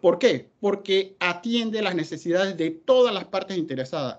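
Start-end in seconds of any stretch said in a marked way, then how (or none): 2.7-3.1 "todas" given extra weight; mild emphasis on "todas"; stress on todas. Real, not acted. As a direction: lean on "todas" so it stands out from the phrase. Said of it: "no dejo de recalcar la palabra todas".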